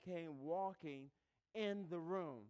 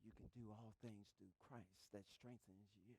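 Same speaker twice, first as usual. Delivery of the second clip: much lower and much softer, hushed in the ear; whisper; 8 semitones lower, 20 dB quieter than usual. Retold or imitated. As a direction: breathe it into someone's ear. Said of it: "she whispered in your ear".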